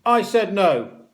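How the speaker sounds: high, loud, drawn-out no; snapped refusal. A narrator's reading. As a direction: shut the boy down firmly. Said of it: "snapped Matron".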